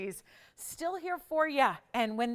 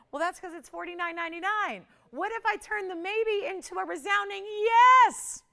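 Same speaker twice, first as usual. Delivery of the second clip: teasing reveal; 9 semitones higher, 7 dB louder than usual. Acted. Host teasing the price reveal.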